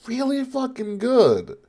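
high, slow, emphatic praise; excited. Real, not acted. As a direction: really sell how good it is. Enthusing about the new theme.

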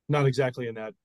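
ordinary delivery

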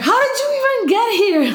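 very high, fast disbelief; husband startled by the toddler; shocked high squeal. Retold or imitated. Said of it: "he's like".

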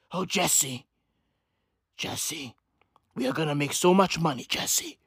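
low, hushed imitation of a scheming drug cook; Heisenberg impression. Drug cook gloating to his partner about money, low impression. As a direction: murmur it low like a TV drug kingpin. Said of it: "Johnson and Johnson has Heisenberg".

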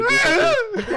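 shouting, voice cracks up high; voice cracking. Shown as a demonstration. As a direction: yell until the voice breaks. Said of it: "kinda trying to yell at somebody".

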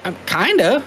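high, sheepish "I'm kinda"; admitting he half understands the Cajun; sheepish admission. Retold or imitated. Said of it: "And I'm like".

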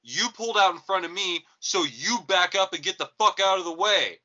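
high, loud road-rage yell; angry driver. Retold or imitated. Driver telling off the man who cut him off, angry yell. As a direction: imitate a furious driver shouting.

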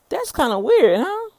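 "weird" said loud and high, huh jumping up; playful amusement. Real, not acted. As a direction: toss it out with an amused lift. Playful self-remark, repeated.

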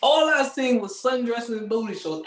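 ordinary talk

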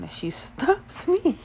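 high, slow words breaking into real laughter; laughing through words. Real, not acted. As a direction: crack up while telling it. Cracks up telling the story.

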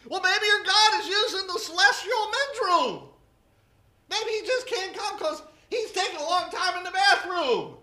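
loud, high, cheeky mockery; mocking jab. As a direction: rib them with a bathroom joke. Elijah's imagined bathroom taunt.

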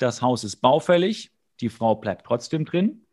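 usual speaking voice

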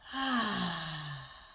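long audible exhale sigh; loud sigh. Shown as a demonstration. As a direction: let the air out with a big sigh. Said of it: "we exhale with a loud sigh".

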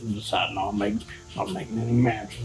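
low, soft grumble to oneself; acted grumpy father, low and quiet; grumbling. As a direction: grumble under your breath. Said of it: "Jimbo grumbling".

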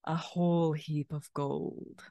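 quietly greedy pirate queen; slow sly pirate queen. Acted. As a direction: savour every word like treasure. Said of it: "a slow smile crawls to her lips".

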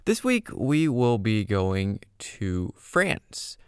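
ordinary talk, nothing marked

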